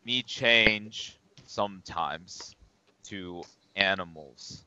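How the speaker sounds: slow, halting, softly spoken brute; Nordor. Acted. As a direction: plod through it word by word, quietly. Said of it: "Nordor would say".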